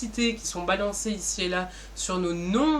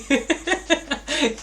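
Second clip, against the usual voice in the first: sudden high giggle that breaks the sentence; can't hold back a laugh mid-sentence; burst of laughter; 7 semitones higher, 4 dB louder than usual. Real, not acted. Presenter cracking up while introducing Intercom Réunion, laughing.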